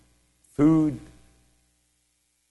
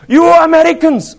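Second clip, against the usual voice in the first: high, loud exclamation, mimicked; excited stranger; 10 dB louder than usual. Short man accosting visitors in the market, high excited shout. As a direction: call out in mock amazement, pitched way up.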